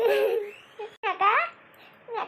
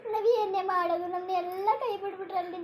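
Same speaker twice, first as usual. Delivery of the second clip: ordinary talk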